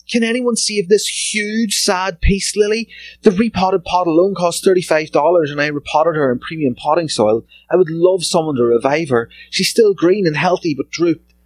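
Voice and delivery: high-pitched plant owner's plea; mock pleading. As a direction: read the post as an over-earnest appeal. Mockingly reads plant owner's plea.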